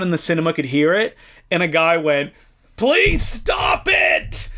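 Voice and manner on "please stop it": high, long drawn-out mock shout; imitated yell. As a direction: imitate a fed-up moviegoer yelling slowly. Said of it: "the guy who yelled".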